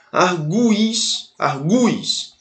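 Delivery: "Arguis" slowly, with the U fully sounded and stressed; stressed U pronounced. Shown as a demonstration. Teacher showing the pronunciation is unchanged, "arguis" with a long stressed U. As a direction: hold the U in each "arguis". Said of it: "A pronúncia continua".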